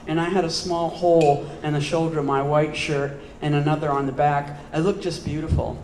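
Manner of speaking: measured, steady poetry recital; poem reading. Acted. Poet reading Holes to an audience, steady recital. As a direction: read it out as a narrative poem.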